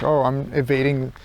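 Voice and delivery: ordinary talk, nothing marked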